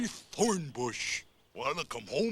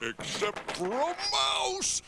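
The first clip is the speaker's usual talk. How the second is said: much higher sudden cry; surprised exclaim; 14 semitones higher than usual. Acted. Weasel finds no mouse, surprised cry. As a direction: gasp and realize it. Gasps, realizes missing mouse.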